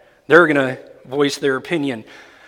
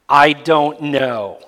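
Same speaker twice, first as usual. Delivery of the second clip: slow emphatic statement; 4 dB louder, much slower than usual. Shown as a demonstration. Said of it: "Can you say it with me?".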